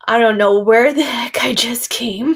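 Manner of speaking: confused, laughing it off; half-laughing disbelief at the weirdness; incredulous giggle; bewildered laugh. Retold or imitated. Bewildered newbie, laugh heard.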